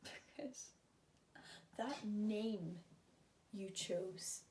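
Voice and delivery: very soft, broken up by pauses; quiet, halting. Acted. Patient confronts Vivi about the name chosen, quiet and halting. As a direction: let the words out softly, one small piece at a time.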